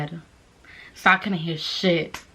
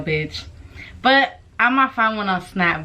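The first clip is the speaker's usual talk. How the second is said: ordinary talk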